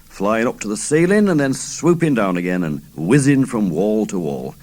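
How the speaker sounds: ordinary delivery, nothing marked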